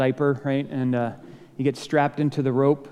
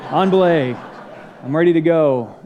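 louder, laughing on belay; laughing call; 2 semitones higher, 6 dB louder than usual. Retold or imitated. Climber starting the climb, loud and laughing. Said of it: "you know, like".

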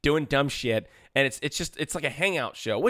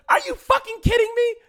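much higher, louder exasperated yell; mock outrage at the ending; high, loud, hammed-up disbelief; outraged disbelief; 8 dB louder than usual. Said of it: "And I'm just like".

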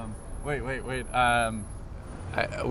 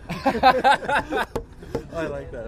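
laughing; 12 semitones higher, much slower than usual. Real, not acted. Laughs at nickname suggestion.

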